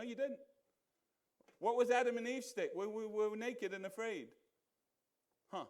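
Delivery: ordinary talk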